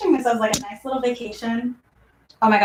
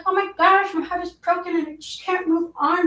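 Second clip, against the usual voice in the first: mock heartbroken; 8 semitones higher than usual. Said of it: "so I'm just like".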